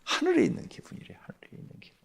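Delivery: hushed, fading echo; softly marveling retelling; awed whisper. Retold or imitated. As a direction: whisper it in wonder, trailing away. Echoing testimony of heavenly bliss.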